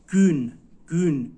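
گون said slowly and loudly with a rounded ü; Turkish gün syllable. Shown as a demonstration. Teacher correcting a student's Turkish pronunciation, loud. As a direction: hammer گون with rounded lips, slow.

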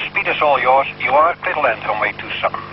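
usual speaking voice

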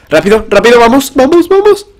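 high shout climbing to a squeal; loud, very high-pitched cries; frantic urging. Real, not acted. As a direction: urge yourself on in panic. Frantic moment in close fight.

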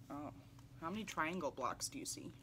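ordinary delivery, nothing marked

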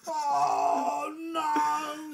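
high fed-up wail; exasperated dad. Acted. Sleepless dad at his wits' end, high wailing cry. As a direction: wail it like a worn-out father. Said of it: "said Dad".

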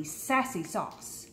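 usual speaking voice

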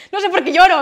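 half-laughing about crying; laughing through it. Retold or imitated. Telling her mother she cries for no reason, laughing.

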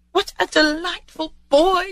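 high, drawn-out delight; delighted Scrooge. Acted. Scrooge admires the boy, slow high delight.

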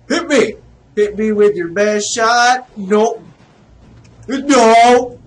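loud, high, playful taunts; taunting yell. Pretend. Gamer daring the enemy to hit, taunting. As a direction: call out a dare to the attacker.

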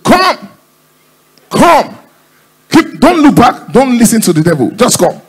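high, loud preacher summoning; pastor's altar call. Acted. Pastor calling a giver to come, urgent preaching. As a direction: urge them forward in a high, insistent shout. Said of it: "the pastor said".

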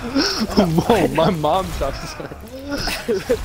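high, very slow whoa; mocking friend. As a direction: imitate the offended buddy.

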